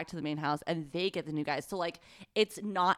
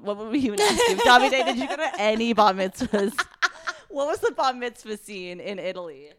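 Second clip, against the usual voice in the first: laughing through silly questions; higher and louder, cracking up as she asks; giggling questions; 4 semitones higher, 6 dB louder than usual. Real, not acted. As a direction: giggle while pretending to interview a reality star. Imagines interviewing Davide, giggling.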